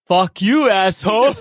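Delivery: loud, laughing curse; laughing yell. Retold or imitated. Retelling Cook running out of bullets, laughing. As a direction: spit it out while cracking up. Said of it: "He goes".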